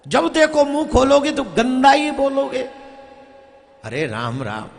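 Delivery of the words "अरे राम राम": soft, dismayed sigh. Real, not acted. Preacher sighing at the crowd. (निराश होकर धीमी आह)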